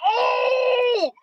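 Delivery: high, loud whooping oh; excited shout. Retold or imitated. Friends hollering at the cousin who got dropped, excited high whoops. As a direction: crowd egging on a knockdown.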